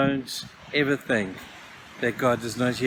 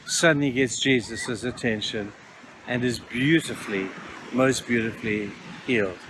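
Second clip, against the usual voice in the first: tender, softening; 4 semitones lower, 5 dB quieter than usual. Real, not acted. Preacher savours the healing.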